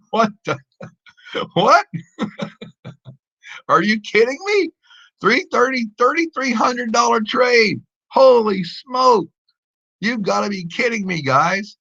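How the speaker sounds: high, disbelieving, laughing excitement; can't believe the win, pitch way up; real excitement. Real, not acted. Trader stunned by a huge win on stream, high and excited. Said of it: "I'm so freaking out right now".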